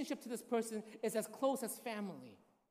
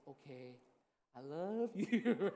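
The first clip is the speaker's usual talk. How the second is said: put-on calm him like a fussy child; soothing; 6 semitones lower, much slower than usual.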